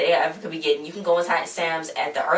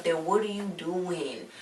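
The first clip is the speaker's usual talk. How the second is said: usual speaking voice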